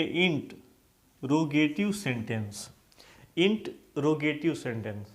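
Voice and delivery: इंटरोगेटिव broken slowly as "int, ro-ge-tiv"; careful model pronunciation. Shown as a demonstration. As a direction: teach इंटरोगेटिव in parts, pausing after "int". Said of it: "आर को नहीं पढ़ना है". (इंटरोगेटिव को "इंट... रोगेटिव" तोड़कर बोलें)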